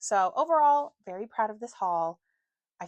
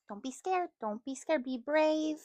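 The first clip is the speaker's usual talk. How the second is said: higher, gentle reassurance; 8 semitones higher, slower than usual. Real, not acted. Mother reassuring her scared baby, higher and gentle. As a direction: coax the little one to be brave.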